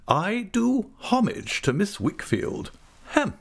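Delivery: high and slow, ornately courteous; genteel, bowing Micawber. A narrator's reading. Micawber bowing to Miss Wickfield: slow, genteel, courtly homage. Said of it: "bowing with his genteelest air".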